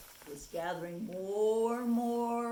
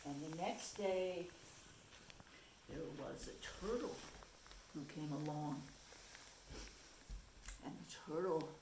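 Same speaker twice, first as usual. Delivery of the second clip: plain reading voice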